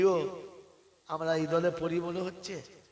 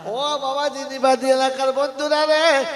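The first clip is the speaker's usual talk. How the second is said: high, loud call to the congregation; loud preacher's appeal; 6 semitones higher, 8 dB louder than usual. Acted. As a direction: call out to the friends. Calling out to audience.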